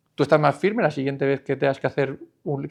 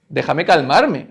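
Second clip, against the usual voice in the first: ordinary talk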